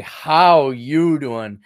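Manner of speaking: drawn-out, very slow come-on; slow flirty greeting. Retold or imitated. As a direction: slowly greet her like a smooth flirt. Flirty greeting to hot babe.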